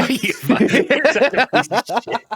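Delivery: high-pitched, cracking up while cursing; laughing insult. Real, not acted. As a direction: curse your buddy through helpless laughter. Friend mock-insults him while laughing.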